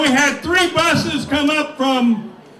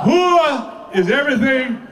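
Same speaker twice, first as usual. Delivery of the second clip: ordinary talk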